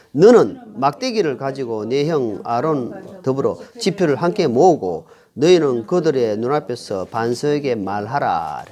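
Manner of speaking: slow, low read-aloud of a Bible verse; scripture reading. Acted. Preacher leads the congregation in reading God's command to Moses, scripture reading. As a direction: read it out slowly and solemnly, phrase by phrase.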